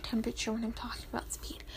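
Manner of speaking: quick whispered self-mockery; whispered aside. Real, not acted. Vlogger catches her own slip, fast whisper. Mocking herself mid-ramble.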